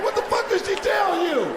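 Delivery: high, hammed-up angry mother; mother yelling. Angry mother in the car, hammed-up high yell. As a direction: yell it up high like a furious mom.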